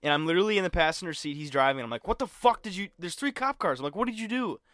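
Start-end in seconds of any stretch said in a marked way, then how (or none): 2.1-4.6 panicked yell. Retold or imitated. Panicked at friend, cops behind.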